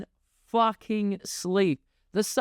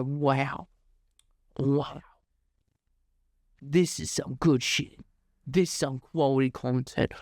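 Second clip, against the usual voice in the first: lower, hushed mock admiration; mock awed viewer; 9 semitones lower than usual.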